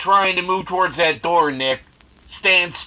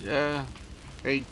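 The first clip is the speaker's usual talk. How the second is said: ordinary talk, nothing marked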